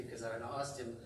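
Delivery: ordinary talk, nothing marked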